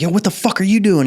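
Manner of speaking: high mock yell at the private; imitating a cadre yelling; retold yell. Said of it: "He yells at the private dude".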